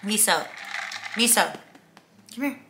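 calling a pet by name. Real, not acted. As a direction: call out to the dog. Calling the dog.

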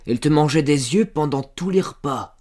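loud, high, pressing interrogation; interrupting. A narrator's reading. Farmer cross-examining servant girl about Jacques, interrupting. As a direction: cut in and badger her for an answer. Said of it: "Il l'interrompit".

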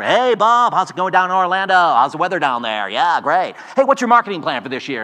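loud, high, pushy telemarketer patter; a loud cold-caller's fake cheer; Noisy Pete sales pitch. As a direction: hammer the phone script like a boiler-room salesman. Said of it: "this guy named Noisy Pete".

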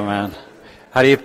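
ordinary delivery

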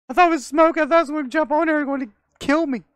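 high, fast startled rush; excited. Real, not acted. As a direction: blurt it out in a fright. Startled by game enemy.

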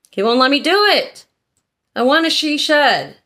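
high-pitched, playful protest; mock pleading complaint. Wife complains she needs permission for a she shed, mock pleading. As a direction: plead your case like someone denied a treat.

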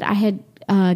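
ordinary delivery

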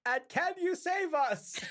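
high, drawn-out call into the mist; calling for help. Acted. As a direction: call out slowly to someone far away.

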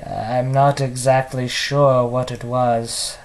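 subdued villain, hushed and brooding; quiet, halting supervillain reminiscence with long pauses; Mark Hamill Joker. Acted. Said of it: "my Mark Hamill impression".

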